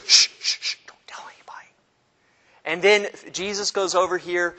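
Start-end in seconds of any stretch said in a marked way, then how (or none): 0.1-1.7 quick, secretive whisper; hammed-up hush-hush whisper; urgent hushing. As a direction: shush them and whisper it fast. Jesus hushes the crowd.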